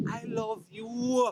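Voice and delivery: slow, tender i love you; gentle heartfelt declaration; sincere. Real, not acted. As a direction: say it like you mean it to the viewer. Sincere closing to viewers.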